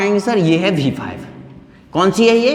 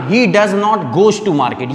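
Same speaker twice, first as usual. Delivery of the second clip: slow wrong example; much slower than usual. Shown as a demonstration. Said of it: "आप इसको ऐसे नहीं बोलना".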